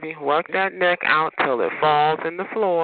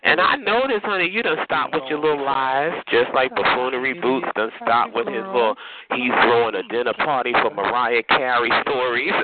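usual speaking voice

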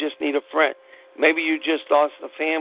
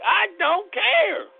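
high, slow cry of feeling; much slower than usual. Real, not acted. Emotional outburst on faith.

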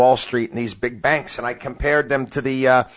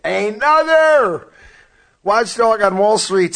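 high stretched show-title read; announcer voice; 8 semitones higher, 4 dB louder, slower than usual. Acted. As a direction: announce the segment name. Branded segment intro.

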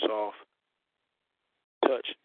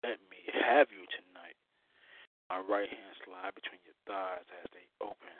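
right in your ear; 6 semitones lower, 7 dB quieter than usual. Acted. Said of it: "as I whisper in your ear".

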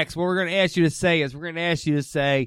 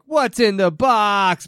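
slow, louder movie-star delivery of "What's in the box?"; Brad Pitt line; 4 semitones higher, 6 dB louder, much slower than usual. Retold or imitated. Asking a friend for the line, slow dramatic movie quote. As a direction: drag it out like the famous scene. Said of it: "his, like, attitude and the way he goes".

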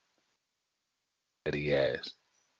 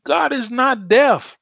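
higher, slow, every word stressed; emphatic declaration; 11 semitones higher, 4 dB louder, slower than usual. Real, not acted. Preacher insisting to congregation, slow and high. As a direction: drive it home slowly. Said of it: "preacher emphasis".

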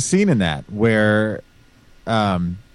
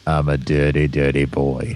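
low-pitched mock Australian accent; 4 semitones lower than usual. Shown as a demonstration. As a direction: imitate an Australian, voice dropped low. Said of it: "Give me an Australian".

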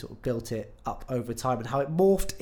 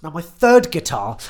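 loud joking announcement; mock announcement; 3 semitones higher, 13 dB louder than usual. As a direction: proclaim it as a joke. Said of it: "No, no, I'm joking".

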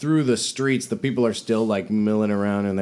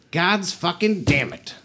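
high, drawn-out angry oath; exasperated cursing; 10 semitones higher, 3 dB louder than usual. Acted. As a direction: curse at bad news, slowly and high. Trader reacts to whispered bad news.

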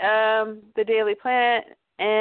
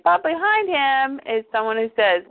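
usual speaking voice

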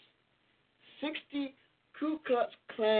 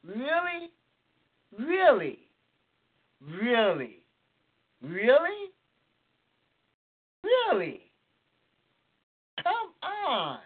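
high, loud disbelieving Really over and over; each Really a pitched-up stunned disbelief; incredulous; 6 semitones higher, 5 dB louder, much slower than usual. Retold or imitated. Host reacting to an absurd Klan story, incredulous. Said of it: "I'm going".

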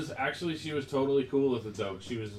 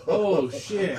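laughing "oh shit" realization; panicked, laughing it out; alarmed laugh; 6 semitones higher, 8 dB louder than usual. Retold or imitated. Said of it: "I was like".

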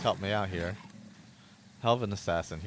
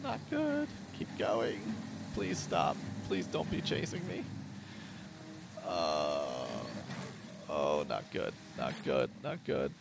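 panicked, voice jumping higher; fleeing in a panic; being chased; 5 semitones higher than usual. Real, not acted. Fleeing a saber cat in game.

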